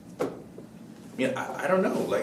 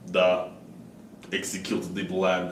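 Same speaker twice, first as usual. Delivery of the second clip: low, quiet Russian spy voice; Russian accent; 9 semitones lower, 10 dB quieter, slower than usual. Retold or imitated. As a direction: give the order like a Russian spy boss. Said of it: "Putin's gonna call her and be like".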